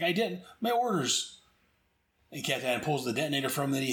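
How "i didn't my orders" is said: flustered officer. Retold or imitated. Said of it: "He's like".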